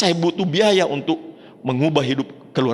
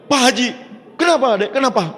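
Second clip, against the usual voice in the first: eager caller; 9 semitones higher than usual. Retold or imitated. Caller replies on the phone.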